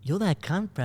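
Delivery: blunt bloke voice, lightly put on; imitating a random guy. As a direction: copy the stranger's blunt greeting. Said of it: "I'll do exactly how he did it".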